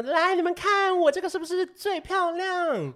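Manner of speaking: high, slow, vain and preening; show-off. Retold or imitated. Mocking a show-off newcomer.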